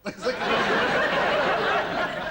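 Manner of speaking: high slow words through a giggle; giggling. Acted. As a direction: savour the pun laughing. Laughs at own pun.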